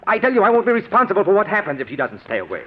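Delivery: angry threat. Acted. Husband warns wife about her mother, fast angry threat. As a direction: let the rage spill over.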